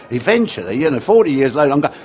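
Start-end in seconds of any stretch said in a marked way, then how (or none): none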